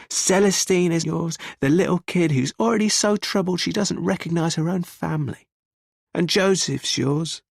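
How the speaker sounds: high, scornful accusation; contemptuous. A narrator's reading. Man confronts the armed husband about the children, contemptuous. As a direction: throw each charge at him with contempt. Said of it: "his face is full of contempt".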